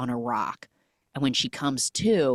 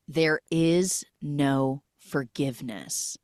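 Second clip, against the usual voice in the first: slow, spaced-out delivery; solemn pronouncement; slower than usual. Retold or imitated. Naomi pronouncing judgment as it burns, slow and measured. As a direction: draw out each word as a verdict.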